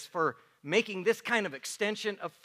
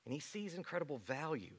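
tender appeal; 7 semitones lower, 17 dB quieter than usual. Acted. Pastor comforting congregation about Jesus's view, tender hush. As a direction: lower your voice and comfort them.

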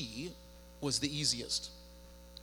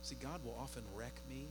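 much softer, hushed and earnest; quiet reflective preaching; 12 dB quieter than usual. Acted. Pastor softly tells the church how God convicts him. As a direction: let it drop to a hush to draw them in.

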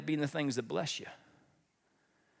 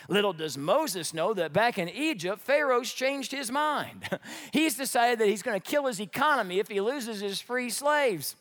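ordinary talk

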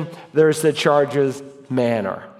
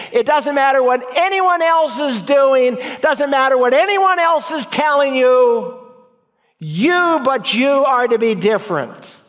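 fiery preaching; 5 semitones higher than usual. Acted. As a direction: press the point home to the congregation. Preaching at full force.

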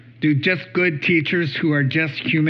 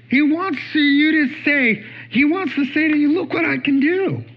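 high-pitched, urgent plea voicing Jesus; eager, insistent appeal; 9 semitones higher than usual. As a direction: lift the voice high, urging the listener. Voices Jesus showing his power.

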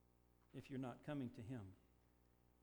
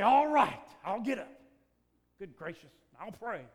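exasperated give-in; 6 semitones higher, 18 dB louder than usual. Retold or imitated. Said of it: "I say".

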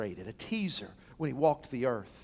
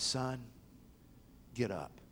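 hushed command; 5 semitones lower, 11 dB quieter than usual. Acted. Jesus raising dead son.

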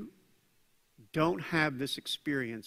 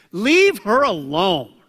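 slow, louder and commanding; firm rebuke; 4 semitones higher, 10 dB louder, slower than usual. Acted. Jesus to the critics of the woman, slow firm rebuke. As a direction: draw each word out to shut them down.

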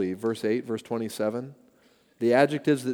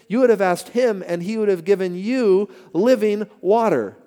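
raised, ringing preacher's read of the verses; scripture reading; 6 semitones higher, 6 dB louder than usual. Acted. Pastor reading Jesus' words to the congregation, proclaiming.

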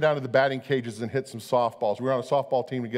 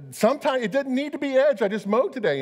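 defensive whine; 9 semitones higher than usual. Retold or imitated. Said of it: "And I said".

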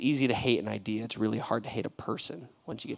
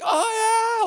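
high squeaky goofy "oh yeah"; playful high-pitched goof; 8 dB louder than usual. Retold or imitated. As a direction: clown around with a friend. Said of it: "I would be like".

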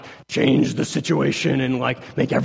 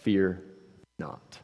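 quiet fear not; 7 semitones lower, 7 dB quieter than usual. Shown as a demonstration. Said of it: "most repeated command".

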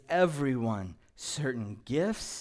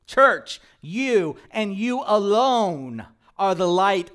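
loud, higher preaching cadence; full-force preaching; 6 semitones higher, 10 dB louder than usual. Acted. Pastor urging the church to shine, preaching at full volume. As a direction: drive it home to the congregation.

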